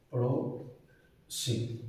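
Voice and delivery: पड़ोसिन stretched very slowly and softly; drawn-out model word. Shown as a demonstration. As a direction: draw out पड़ोसिन slowly for the class. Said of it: "ये हो जाएगा".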